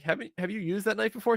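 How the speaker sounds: high worried question. Retold or imitated. Brother's wife checks about the knife, high and uneasy.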